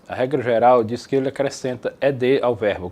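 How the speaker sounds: ordinary delivery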